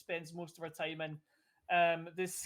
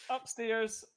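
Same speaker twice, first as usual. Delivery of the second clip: ordinary delivery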